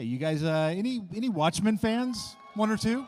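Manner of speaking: loud, high call to the crowd; hyping the crowd. Real, not acted. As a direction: pump up the audience. Host hypes crowd on stage.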